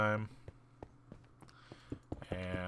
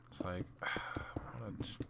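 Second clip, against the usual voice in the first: slow whispered frustration with a sigh; muttering at the customer; muttered aside; 9 dB quieter, slower than usual. Real, not acted. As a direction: breathe it out through a sigh.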